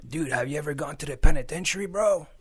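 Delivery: mocking thug taunt rising on bro; taunting passenger. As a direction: goad the driver like a thug.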